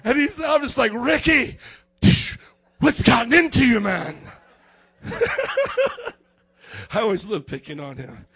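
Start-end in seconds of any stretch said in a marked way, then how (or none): 0.9-4.2 mock exasperation. Acts exasperation at weeping friend. 5.0-6.1 high, cackling laugh. Real, not acted. Preacher cracks up after teasing his friend, high laugh.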